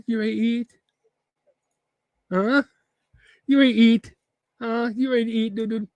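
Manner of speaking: high, excited pet-talk voice; high singsong teasing; teasing dog talk. Real, not acted. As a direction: wind the dog up with high-pitched questions. Teasing his dog about dinner.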